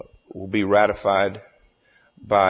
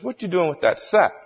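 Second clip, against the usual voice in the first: joke-teller's rough go at Cajun, pitched up; Cajun storyteller; 10 semitones higher than usual. Acted. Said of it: "tells the story in his hilarious Cajun accent".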